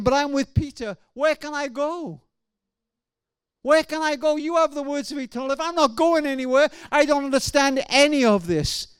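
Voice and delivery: high, pleading, frustrated protest; retold anger. Grieving father recalls questioning God, retold angry anguish. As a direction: act out a bereaved man's bewildered outcry. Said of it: "there was anger".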